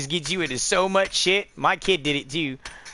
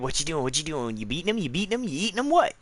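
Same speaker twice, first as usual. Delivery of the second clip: rapid questions climbing in pitch, softly; inquisitive dog; 5 dB quieter, faster than usual. Pretend. Dog checking on a crying boy in bed, nosy and insistent. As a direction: play a nosy dog firing off worried questions.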